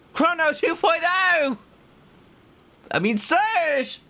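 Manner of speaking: drawn-out high excited cry; excited call. Acted. Player excitedly calls out a character's name, drawn out and high.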